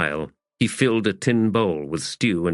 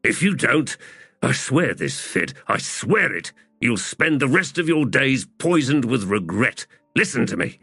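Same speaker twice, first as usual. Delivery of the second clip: higher-pitched, insistent argument; urgent pleading; 7 semitones higher than usual. Acted. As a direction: plead to make him see sense. Hedge pleads urgently in a quarrel.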